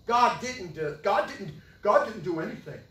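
usual speaking voice

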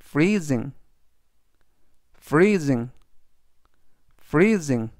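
"freezing" stressed on FREE, with a long ee and a buzzing z; teacher models "freezing" for learners; model pronunciation. Shown as a demonstration. Teacher says "freezing" for students, clear and unhurried. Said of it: "이라고 발음하시면 되겠습니다".

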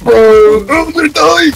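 high, loud panicked yelp; panicking gamer. Real, not acted. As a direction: shout it like you're about to die. Under fire in firefight.

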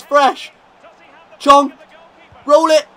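loud, high urging call; will him to score; urgent shout. Real, not acted. Manager urging his striker through on goal, shouting.

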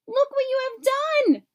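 high, loud scolding; angry shout. A narrator's reading. Even Steven scolding Odd Todd for crashing into the garden. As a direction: shout the blame at him. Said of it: "he shouted".

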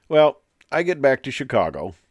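ordinary talk

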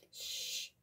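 sharp unvoiced shush. A narrator's reading. Grandfather hushes Rahib while listening for the frog, whispered. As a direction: hush the room with a breathy shh. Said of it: "said his grandfather".

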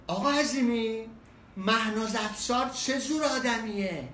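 slow, loud shouted question. Retold or imitated. Attendant yelling a nosy question across the station, slow loud shout. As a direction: yell the question across twenty metres. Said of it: "یکی داد بزنه".

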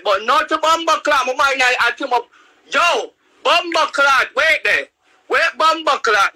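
venting real frustration; fired-up angry ranting; heated rant. Real, not acted. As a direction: pitch up, voice rising in anger. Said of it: "I'm getting angry".

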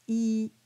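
"I" as a pure ee; unrounded starting vowel. Shown as a demonstration. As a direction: say "I" with unrounded lips. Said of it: "Start with an E".

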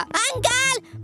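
calling out. Real, not acted. Calling out to game uncle.

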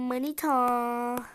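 drawn-out loud call across the room; slow, loud call to a sweetheart. Acted. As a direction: stretch the name out to reach her. Calling out to girlfriend.

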